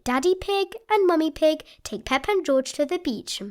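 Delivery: plain reading voice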